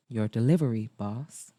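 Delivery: Maxwell. Acted. Maxwell delivers coffee to his boss, low voice. Maxwell greets her, in character.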